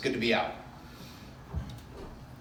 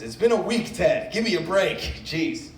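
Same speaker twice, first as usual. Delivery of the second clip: exasperated; 5 semitones higher, 3 dB louder than usual. Real, not acted. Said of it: "exasperated plea to audience".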